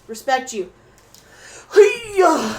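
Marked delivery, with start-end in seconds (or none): 1.7-2.5 high, loud karate-style cry; punchy raised exclamation; playful yell. Pretend. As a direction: let out a sudden goofy shout. Sign-off exclamation.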